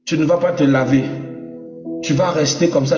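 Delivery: ordinary talk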